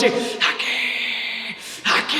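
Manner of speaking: eerie ghostly call, held back in volume; mock cry of a phantom pirate; headless pirate's cry. A narrator's reading. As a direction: stretch it out like a ghost calling. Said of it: "gritando en medio de la noche".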